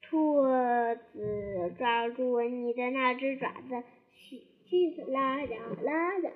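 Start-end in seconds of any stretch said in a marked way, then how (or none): none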